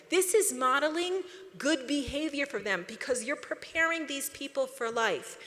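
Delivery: ordinary delivery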